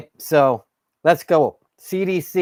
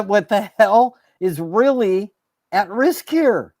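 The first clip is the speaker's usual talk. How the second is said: indignant shout; 6 semitones higher than usual. Real, not acted. Vlogger yelling at officials who hid the truth, indignant. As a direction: throw it back at them, outraged.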